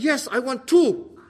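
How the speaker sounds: loud, slow, emphatic acceptance; hammed-up forthright answer; speaking up. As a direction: say it out boldly, word by word. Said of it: "you must speak".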